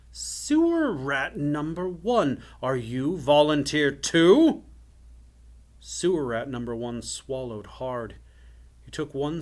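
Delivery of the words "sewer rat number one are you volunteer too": slow, loud, high mock inquiry; menacing enchanter. A narrator's reading. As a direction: draw it out, pouncing on your next victim. Enchanter menaces the sneezing boy.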